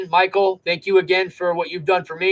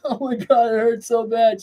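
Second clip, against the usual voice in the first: mock whimper; 7 semitones higher than usual. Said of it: "And I'm like".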